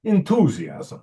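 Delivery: a New York "enthusiasm" with a stutter on "En-"; New York twang on "enthusiasm"; New York accent. Shown as a demonstration. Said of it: "you gotta say it with a New York accent".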